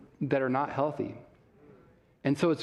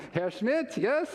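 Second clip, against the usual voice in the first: higher, stiff formal German handshake voice; stiff German greeting; 4 semitones higher than usual. Retold or imitated. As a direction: play the reserved German, formal and distant. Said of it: "I'm German, right? So I'm, like, more like".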